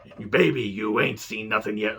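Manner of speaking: gravel-voiced Macho Man promo, imitating; Randy Savage impression. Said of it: "Doing a Vince and Savage impression".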